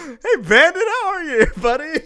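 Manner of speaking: very high, loud and breaking into laughter; overdone nervous greeting. Acted. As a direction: cover being caught with a loud, laughing hello. Caught out, greets Bandit again.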